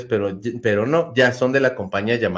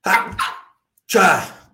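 sneezing; 6 semitones higher than usual. Real, not acted. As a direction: let out a sudden sneeze. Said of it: "Voy a estornudar".

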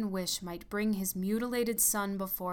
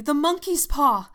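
frantic, much higher and louder cry; wild cry; 8 semitones higher, 12 dB louder than usual. Acted. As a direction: cry it out wildly in sudden terror. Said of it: "she cried wildly".

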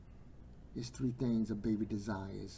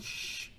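drawn-out "shh"; hushing shush; 3 dB quieter than usual. Acted. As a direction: shush someone in church. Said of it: "didn't want him to say nothing".

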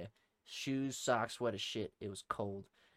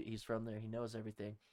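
normal talking voice

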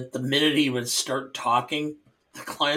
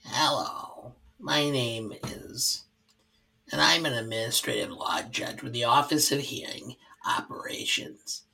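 droopy Brooklyn judge; 3 dB quieter than usual. Acted. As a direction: drone softly like a droopy old-school judge. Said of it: "Your impression of him sounds like Drippy Dog".

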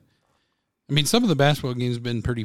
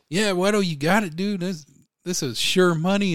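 acted confident excitement; 7 semitones higher, 3 dB louder than usual. Acts out confident bettor mid-game.

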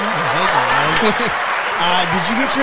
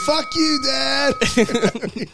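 scream; 9 semitones higher, much slower than usual. Pretend. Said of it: "you're just gonna scream upstairs".

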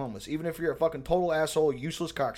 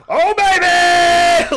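high, slow, much louder hype yell; hammed-up Ronnie Coleman style yell; hyped gym shout; 13 dB louder, much slower than usual. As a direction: bellow it like a bodybuilder mid-rep. Imitating guy on monkey bars.

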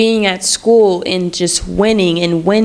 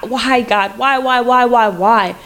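a mild echo of a hysterical cry to God; retold hysterical crying; 2 semitones higher than usual. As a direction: replay the desperate plea, only lightly voiced. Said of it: "I just remember crying, like, hysterically".

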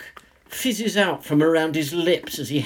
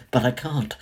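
plain reading voice

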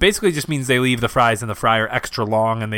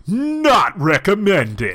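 announcer voice; 7 semitones higher, 3 dB louder, much slower than usual. Pretend. Mock catchphrase sign-off.

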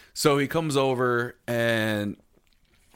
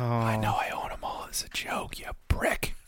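whispering; 6 dB quieter than usual. Real, not acted. Whispered aside.

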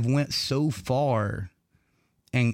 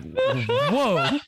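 surprised whoa; 13 semitones higher than usual. Retold or imitated. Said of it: "I'm like".

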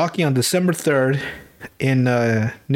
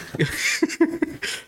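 high laugh; 14 semitones higher, 4 dB quieter than usual. Real, not acted. Laughing at the impression.

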